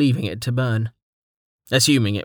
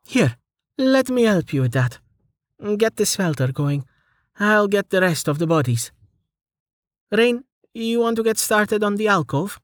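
bright, higher woman's voice; young woman voice; 9 semitones higher than usual. A narrator's reading. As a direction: voice Amelia up high and eager. Amelia speaks, voiced higher.

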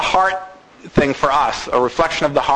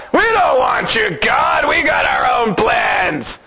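defiant shout; 6 semitones higher than usual. Pretend. Voicing rebellious people.

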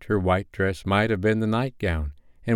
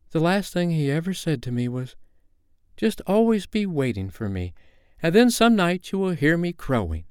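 higher, lilting storybook voice; gently acted character, pitched up; lightly voiced Wendy, reminiscing; 9 semitones higher than usual. A narrator's reading. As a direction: lightly play a woman recalling a promise. Narrator voices Wendy recalling Peter.

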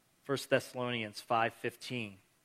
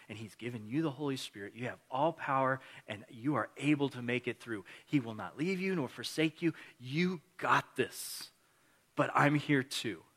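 ordinary talk, nothing marked

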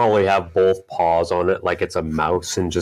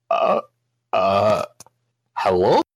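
hesitant greeting; slower than usual. Acted. Wide-eyed cat greets a strange kobold, hesitant. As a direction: stammer uncertainly, ending on a rise.

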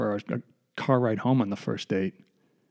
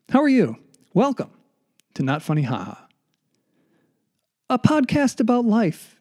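show host intro; 9 semitones higher than usual. Acted. Podcast host opening greeting.

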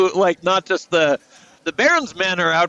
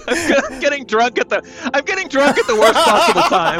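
laughing; 8 semitones higher, 5 dB louder than usual. Real, not acted. Player laughing about drunk character.

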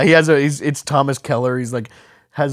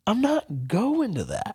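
refusing; 5 semitones higher, 7 dB quieter, slower than usual. Retold or imitated. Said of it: "And I'm like".

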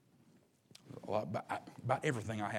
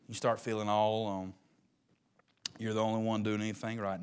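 ordinary talk

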